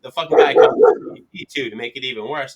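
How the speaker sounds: ordinary delivery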